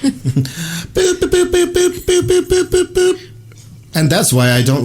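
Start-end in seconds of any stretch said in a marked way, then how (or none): 0.0-3.3 very high repeated beeping boops; spoken morse code. Pretend. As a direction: imitate a censor bleep as boops. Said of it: "it's just gonna sound like Morse code".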